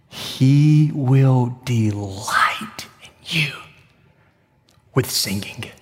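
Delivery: slow, hushed whisper; reverent whisper. Shown as a demonstration. Preacher whispering his favorite verse to the congregation. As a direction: whisper it like a treasured secret. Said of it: "my favorite part of the verse".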